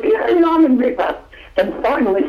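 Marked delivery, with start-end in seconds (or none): 0.0-1.2 high, held-pitch mock yell; imitated German shout, pitched way up; yelled-out demand. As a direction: yell it from the back of the auditorium. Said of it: "this guy yells out".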